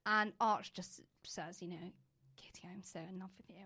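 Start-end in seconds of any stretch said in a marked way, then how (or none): 2.3-3.6 soft retold whisper of a confession. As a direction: breathe it like a secret.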